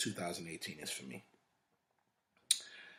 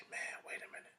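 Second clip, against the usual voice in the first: hushed whisper, catching your breath; whispered aside; 7 dB quieter than usual. Retold or imitated. Telling yourself to stop when life gets tough, whispered.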